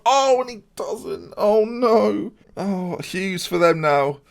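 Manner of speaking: high, drawn-out wail at a miss; slow, despairing commentary; anguished dismay. Real, not acted. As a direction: cry out as hopes collapse. His player misses a penalty.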